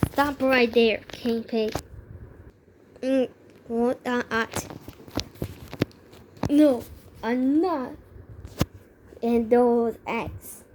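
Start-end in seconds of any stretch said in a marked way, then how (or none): none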